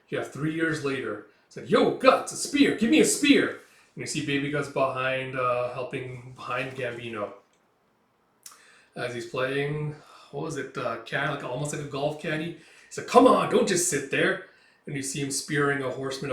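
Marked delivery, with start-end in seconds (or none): 1.7-3.5 high, loud yell for a spear; battle shout. Acted. Mercenary calling to Guts, loud high battle shout. 13.1-14.5 loud impatient snap; angry bark. Acted. Gambino barking at little Guts, loud impatient bark.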